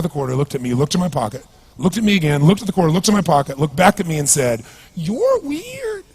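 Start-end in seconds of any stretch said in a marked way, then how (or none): 4.9-6.0 put-on very high, strange drunk's verdict; a drunk beggar calling the speaker weird, very high and strange; bizarre voice. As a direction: squeak it out like the odd drunk. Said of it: "He had this really bizarre looking sounding voice".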